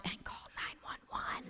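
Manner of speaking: slow, silent, mouthed whisper of the nurse's warning. Retold or imitated. Nurse mouthing the words to her assistant, a slow silent whisper. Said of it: "then she mouthed".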